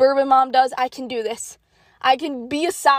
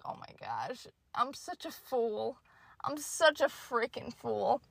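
much softer, slow self-scolding; muttering to herself; 14 dB quieter than usual. Retold or imitated. Shopper quietly calling herself a fool. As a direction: mumble it down at yourself.

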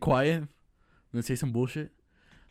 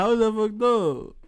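high, loud, laughing objection; laughing protest; 8 semitones higher, 8 dB louder, slower than usual. Real, not acted. Friend defending against being called out, laughing in protest. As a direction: protest loudly through real laughter.

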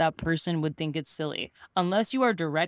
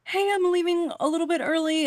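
high-pitched, timid exit line; imitating an anxious goodbye; mock nervous announcement; 12 semitones higher than usual. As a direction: squeak it out like a nervous confession. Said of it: "like, shaking".

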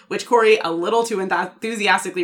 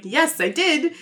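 higher, lightly acted eagerness; retold excitement; 7 semitones higher, slower than usual. Husband answering his shocked wife, mildly excited. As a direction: imitate a husband still pleased with himself. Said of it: "still very excited".

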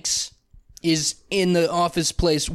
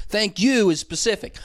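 usual speaking voice

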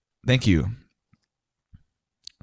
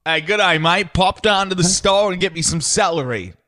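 higher Australian accent; 7 semitones higher than usual. Shown as a demonstration. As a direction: ask for celery with an Australian accent. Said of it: "Australian accent".

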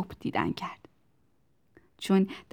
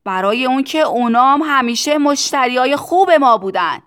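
loud, raised-pitch yell; shouted answer; 5 semitones higher, 12 dB louder than usual. A narrator's reading. Factory owner justifying sales to both sides, shouting. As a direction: justify it at full volume.

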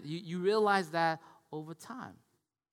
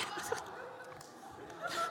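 real laugh; 11 dB quieter than usual. Real, not acted. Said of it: "Sorry, sorry".

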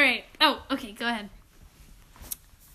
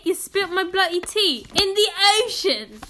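higher-pitched mock British accent; Hermione Granger; 4 semitones higher than usual. Shown as a demonstration. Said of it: "Think of Hermione Granger".

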